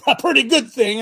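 loud and high cheer for testosterone; pumped rally shout; hyped-up call. Pretend. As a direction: belt it like a rally crowd. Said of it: "Somebody give me a thumbs up, say".

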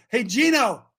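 ordinary delivery

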